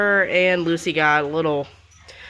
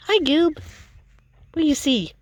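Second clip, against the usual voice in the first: pet talk; 9 semitones higher than usual. Real, not acted. Greeting the dog.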